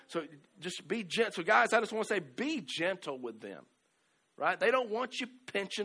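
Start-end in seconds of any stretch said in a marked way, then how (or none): 2.2-3.6 soft earnest plea. Real, not acted. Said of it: "pastor's emphatic advice".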